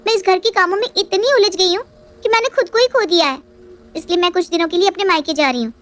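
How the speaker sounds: sulky daughter-in-law pleading her case; Riya's voice. Acted. Riya answers mother-in-law.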